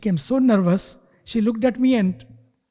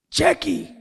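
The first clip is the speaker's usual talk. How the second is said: sharp raised call of the name; shouted name; 8 semitones higher than usual. Retold or imitated. Angry father shouting at his daughter, sharp call. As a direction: snap her name out high like an angry father. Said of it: "and he shouted".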